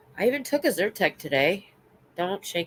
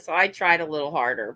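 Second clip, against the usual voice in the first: usual speaking voice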